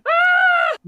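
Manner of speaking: very high, loud scream; hammed-up high scream; imitated scream. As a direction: shriek like a kid with a nosebleed. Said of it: "He was like".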